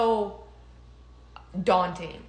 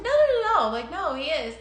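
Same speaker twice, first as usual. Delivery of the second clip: flustered denial; 6 semitones higher, 4 dB louder than usual. Retold or imitated.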